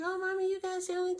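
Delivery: put-on high little-kid voice asking eagerly; child voice. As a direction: play the small child begging for a sandwich. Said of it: "They'd be like".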